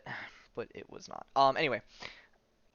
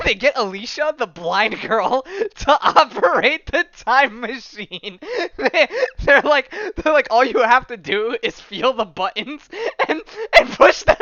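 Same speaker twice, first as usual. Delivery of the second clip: helpless laughing, much higher and louder; laughing hard; 12 semitones higher, 12 dB louder than usual. Real, not acted. Telling how a blind girl runs the time machine, laughing hard. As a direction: barely get the story out through real laughter. Cracks up retelling blind girl's task.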